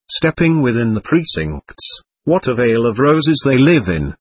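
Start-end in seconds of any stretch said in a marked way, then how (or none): none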